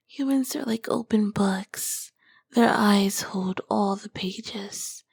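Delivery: seductive ancient demoness, whispered close to the ear; a sultry succubus whispering to her summoner; whispering succubus queen. Acted. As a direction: tease the mortal in a hushed, knowing whisper. Whispered succubus roleplay throughout.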